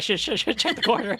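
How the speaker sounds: loud, panicked and high; frantic yelling. Retold or imitated. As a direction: shout it like getting slapped. Mock slap, corner-checking scolding.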